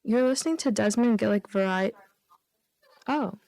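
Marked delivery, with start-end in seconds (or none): none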